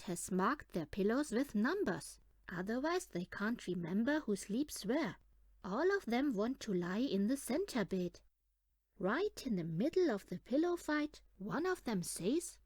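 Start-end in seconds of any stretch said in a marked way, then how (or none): none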